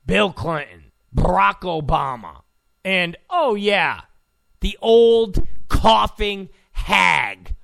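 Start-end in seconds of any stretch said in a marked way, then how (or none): 4.6-7.5 slow, shouted insult; mocking shout. Real, not acted. Host jeering at the candidate, slow shout. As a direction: spit it out with scorn.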